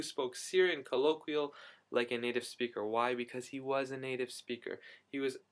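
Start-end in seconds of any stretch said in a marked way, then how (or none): none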